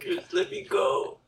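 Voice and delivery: squeaky high laughing plea. Retold or imitated. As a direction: beg through giggles.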